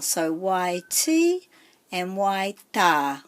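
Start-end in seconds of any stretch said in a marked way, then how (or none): 0.3-1.5 "Y T" slowly, T lengthened; stress on T. Shown as a demonstration. Teacher recapping macron words, long stressed T in "Y T". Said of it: "putting more emphasis on that syllable". 2.1-3.2 "Y ta" slowly, ta lengthened; stress on ta. Shown as a demonstration. Teacher recapping macron words, long stressed ta in "Y ta". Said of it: "putting more emphasis on that syllable".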